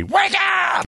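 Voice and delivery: high, loud wake-up yell; wake-up shout. Real, not acted. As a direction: scream it out to wake everyone. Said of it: "we'll scream it together".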